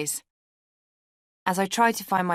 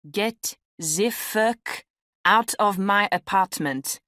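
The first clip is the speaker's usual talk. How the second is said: put-on slow, higher, thick French accent; heavy French accent; 4 semitones higher, 4 dB louder, much slower than usual. A narrator's reading. Angry flatmate ordering a guest out, heavy French accent. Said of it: "he said in a French accent heavier".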